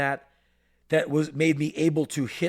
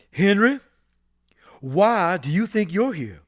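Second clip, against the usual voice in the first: lightly voiced stern general; 3 semitones higher, 6 dB louder than usual. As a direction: boom it out like the school disciplinarian. General Price questions the narrator.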